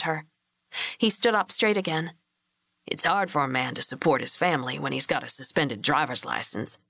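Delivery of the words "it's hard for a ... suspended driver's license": lower, patient rancher husband explaining; lightly voiced steady man, reasonable; husband Hugh J. A narrator's reading. Husband defending Billy to his wife, calm lower voice.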